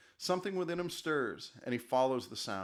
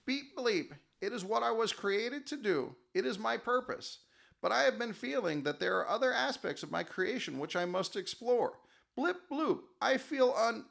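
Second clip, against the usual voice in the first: humble robot; 8 semitones higher than usual. Acted. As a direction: answer modestly like a gentle machine. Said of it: "Fleshbot answers humbly".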